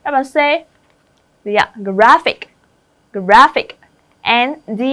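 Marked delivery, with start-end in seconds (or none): 1.8-3.8 "graphic" as GRA-phic, first syllable loud; stress on first syllable. Shown as a demonstration. Teacher drilling word stress for the class, GRA-phic punched. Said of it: "cho âm rơi vào thức thứ nhất".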